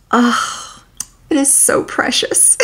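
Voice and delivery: soft unvoiced whisper of delight; whispered gush. Real, not acted. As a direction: breathe it like a secret treasure. Gushing over fictional friendship.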